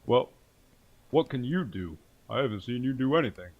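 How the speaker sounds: fat man's voice. Acted. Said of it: "he pipes up and he says".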